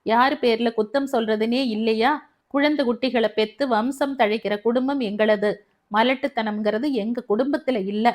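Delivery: ordinary delivery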